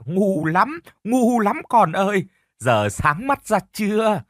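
high, scornful scolding, slowing at the end. A narrator's reading. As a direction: rub the niece's nose in it. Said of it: "chỉ chiết".

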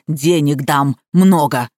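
ordinary delivery

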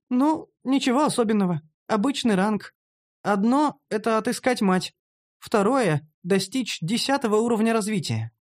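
high female character voice; modest female character. Acted. Narrator voices woman listing quests.

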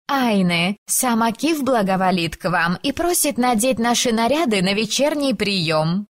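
high sweet chirpy servant voice; chirping. Acted. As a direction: chirp it out brightly. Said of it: "прощебетала одна".

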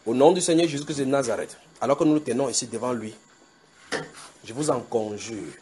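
slow, hushed earnest plea; solemn adjuration. Acted. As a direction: implore the congregation gravely.

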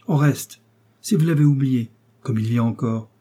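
ordinary delivery

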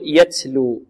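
"يتلو" mispronounced with an s hiss after the ت, like "يتسلو"; ت tinged with س. Shown as a demonstration. Teacher warns against excess breath, ت tinged with س in "يتلو". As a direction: overdo the breath on ت in "يتلو" until it sounds like س. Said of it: "نشرب التاء هنا رائحة السين أو صوت السين".

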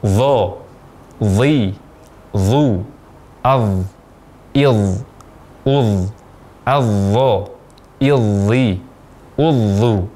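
"adh, idh" held on a thick dh, each form set apart; thick tafkhim dha. Shown as a demonstration. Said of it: "membuat huruf dha menjadi tafkhim atau tebal".

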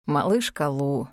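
low, drawn-out wistful breath; sad sigh. A narrator's reading. Dragon breathes heroine's nickname, low and sad. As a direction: sigh it slowly and sadly. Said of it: "почему-то грустно выдохнул Арьек".

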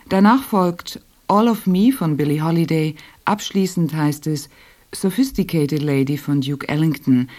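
ordinary delivery

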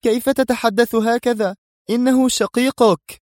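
high, reproachful outcry; scandalised woman's exclamation; indignant. A narrator's reading. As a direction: protest in disbelief, pitched far up. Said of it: "هتفت في استنكار".

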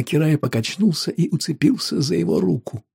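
plain reading voice

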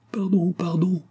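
high, feeble plea; implorante, défaillante. A narrator's reading. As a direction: beg forgiveness as you sink back. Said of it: "dit Antonine en se laissant retomber sur l'oreiller". (supplication aiguë, sans force)

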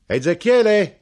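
loud high call across the shop; knife-grinder calling into the dark shop; shouted name. Acted. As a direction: yell the name to be heard inside. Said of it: "gridò".

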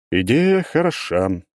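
slow, weighty golem voice; slow pronouncement. Acted. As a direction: pronounce it slowly, grandly. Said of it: "наконец изрекает архимаг посредством управляемого им конструкта".

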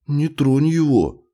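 high, protective cry; alarmed child. A narrator's reading. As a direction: warn him off the pet. Boy protects kitten from father.

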